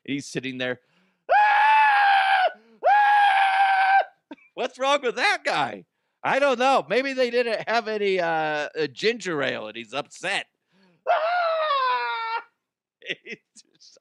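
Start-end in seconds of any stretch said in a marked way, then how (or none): 1.3-4.1 high piercing mock screams of pain; hammed-up yelps of pain. Man stung on plane screaming. 11.1-12.4 mock scream. Man stung on plane screaming.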